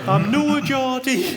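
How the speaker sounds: high, drawn-out, hammed-up Geordie accent; terrible Geordie accent. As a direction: stretch it into a badly faked Sunderland accent. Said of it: "Sorry, that's a terrible accent".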